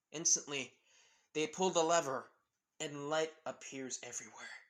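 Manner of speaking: plain reading voice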